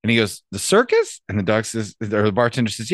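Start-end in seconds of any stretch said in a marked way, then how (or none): 0.5-1.2 high rising disbelief; incredulous question. Acted. Dog offered a circus job, incredulous. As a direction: question it in a much higher voice.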